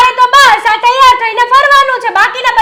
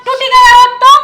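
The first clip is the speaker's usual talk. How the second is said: sharp, raised scolding; mild raised voice, a touch louder; scolding shout; 2 semitones higher, 5 dB louder than usual. Acted. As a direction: scold her for the glasses. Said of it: "ચિલ્લાવ છો શેના?".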